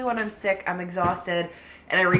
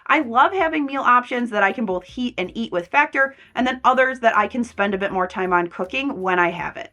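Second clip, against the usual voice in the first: louder upbeat ad read; 3 semitones higher, 7 dB louder than usual. Acted. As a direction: sell it like a sponsor spot. Sponsored ad read.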